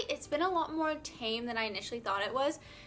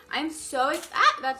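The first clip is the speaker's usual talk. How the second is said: overexcited outburst; 3 semitones higher, 8 dB louder than usual. Real, not acted.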